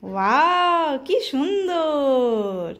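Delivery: loud, drawn-out admiring praise; admiring wow. Real, not acted. Mother praises son in his panjabi, slow delighted gush. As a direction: gush over how lovely he looks.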